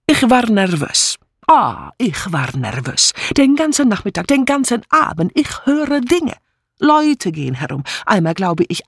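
melodramatic foreign maid, swinging high then settling; agitated, accented testimony; nervous Mitzi. Acted. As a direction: dwell dramatically on your nerves.

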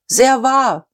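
high-pitched interjection from the audience; called-out agreement. A narrator's reading. Listeners agreeing with old Buck, calling out. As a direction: call it out in hearty assent.

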